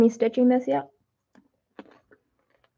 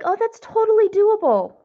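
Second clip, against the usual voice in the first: excited; 10 semitones higher, 4 dB louder than usual. Retold or imitated. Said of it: "And was like".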